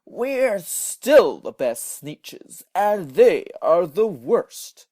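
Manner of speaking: slow, loud, haughty character voice; pompous slow boast; snooty Sneetches. A narrator's reading. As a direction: declare superiority grandly.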